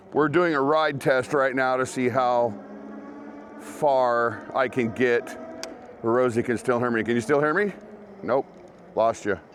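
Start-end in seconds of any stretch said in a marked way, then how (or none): none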